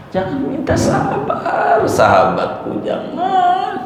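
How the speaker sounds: high, laughing "Jangan minta sabar"; laughing exhortation. Real, not acted. Preacher teasing the congregation, laughing high. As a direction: laugh through the warning.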